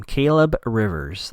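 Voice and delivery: "Caleb Rivers" spaced out slowly; slow, pointed repeat. Shown as a demonstration. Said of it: "Let's keep that in mind".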